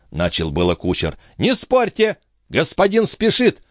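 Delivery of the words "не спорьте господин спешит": high, insistent interruption; brisk high-pitched rebuke; cutting in. A narrator's reading. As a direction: cut the objection short, pitched high. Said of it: "прервал его смотритель".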